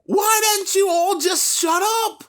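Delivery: shrill, very high yell; high angry shout. Acted. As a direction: snap at the whole class. Kid shouting at the class.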